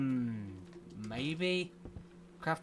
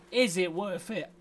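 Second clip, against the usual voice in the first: ordinary talk, nothing marked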